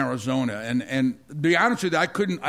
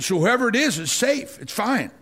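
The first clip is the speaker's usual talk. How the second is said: higher, excited relief; joyful relief in a raised voice; relieved exclamation; 6 semitones higher than usual. Retold or imitated. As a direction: announce that all is well, lifted up. Relieved report after vision.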